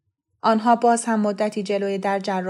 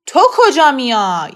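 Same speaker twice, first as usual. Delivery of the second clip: loud, slow angry demand; protesting father; 5 semitones higher, 12 dB louder than usual. A narrator's reading. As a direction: confront the boy, outraged. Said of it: "با اعتراض گفت". (پدری معترض با صدای بلند و کشیده)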